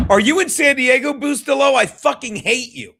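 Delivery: hammed-up envy at a friend in San Diego; mock jealous outburst. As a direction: yell it like a jealous buddy, all joking. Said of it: "by that, I mean I'm jelly".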